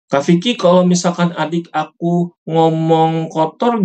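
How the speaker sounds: a hint of a kid asking an older brother; child's question. Child asking Kak Vicky about a sibling's dirty words, lightly voiced child.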